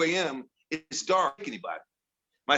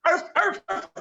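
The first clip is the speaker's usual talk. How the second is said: toy dog bark. Retold or imitated. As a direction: yap like a little robot dog. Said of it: "start barking".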